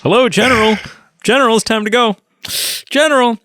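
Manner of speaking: high, nagging whine, mocking the pilot; whiny Carson. Podcast host mocking whiny Carson, high nagging voice. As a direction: pester the General like an impatient kid. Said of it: "oh, he's so whiny here".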